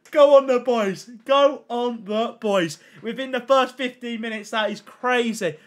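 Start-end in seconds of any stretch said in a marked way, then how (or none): 0.1-1.1 loud rallying cheer to the chat; excited cheer. Real, not acted. Cheering near like milestone. 1.2-2.8 slow, stretched-out cheer; drawn-out cheer. Real, not acted.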